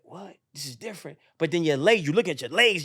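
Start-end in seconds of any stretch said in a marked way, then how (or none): 0.0-1.2 very soft, stunned reaction; hushed puzzlement. Retold or imitated. Runner sees the mountains, hushed surprise. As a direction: say it under your voice, puzzled.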